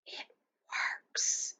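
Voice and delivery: awed breathy whisper; breathed. A narrator's reading. Omri in wonder at the real teepee, whispered. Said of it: "breathed Omri".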